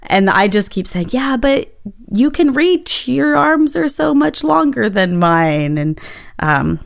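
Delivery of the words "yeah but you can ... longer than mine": higher-pitched, drawn-out protest; retold protest. Wife countering her partner's complaint about reaching back, playful protest. Retells her argument with Corey.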